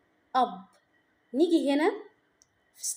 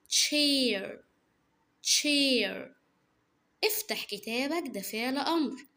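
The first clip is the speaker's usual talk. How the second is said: ordinary talk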